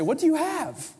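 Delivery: mock challenge, voice jumping high. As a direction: toss it out like a playful challenge. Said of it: "I don't know why I'm talking like this".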